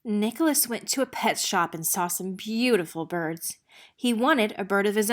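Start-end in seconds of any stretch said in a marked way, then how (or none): none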